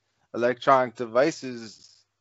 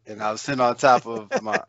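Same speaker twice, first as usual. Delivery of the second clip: ordinary talk, nothing marked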